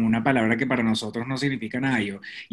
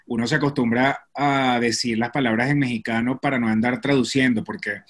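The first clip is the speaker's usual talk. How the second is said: normal talking voice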